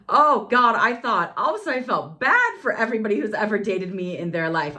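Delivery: high, loud burst of mock horror; dramatic dismay. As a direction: exclaim as if struck by an awful realization. Dramatic dismay at being like her dates.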